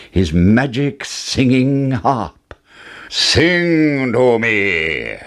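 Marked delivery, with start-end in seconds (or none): 3.1-5.2 very slow, drawn-out giant command; imperious giant voice; giant's roar. Acted. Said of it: "roared the giant".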